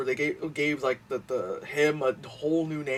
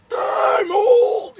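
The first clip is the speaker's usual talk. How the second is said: put-on much higher, loud wailing old lady; 10 dB louder than usual. The old lady pleading with the group, high loud wail. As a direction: wail it like a frail old woman. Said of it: "she's like".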